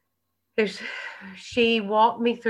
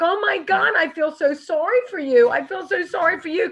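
high, loud, smug mock sympathy; gushing fake sorrow, voice pitched way up; mock pity; 8 semitones higher, 5 dB louder than usual. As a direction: pity them from way up high, loudly. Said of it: "And I'm like".

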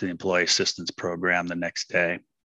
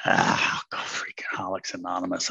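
fast whispered grumble to himself; inner voice whisper; 3 dB quieter than usual. Retold or imitated. Newcomer's inner voice scoffing at AA, whispered. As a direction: hiss it under your breath like a private thought.

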